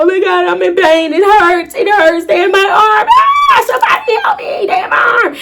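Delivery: panicking patient on the table, shouting for help; retold panic. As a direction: scream for help, voice cracking high. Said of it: "I'm tweaking out".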